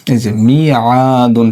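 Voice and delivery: মিয়াদুন drawn out slowly, each syllable clear; slow demo word. Shown as a demonstration. Teacher showing students the correct reading, slow and clear. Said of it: "মিউআদুন থেকে মিয়াদুন".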